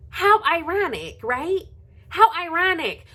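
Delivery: high sing-song sarcasm; high, mocking "how ironic"; sarcastic. Real, not acted. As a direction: twist the knife with mock delight. Sarcastic jab at Nakia.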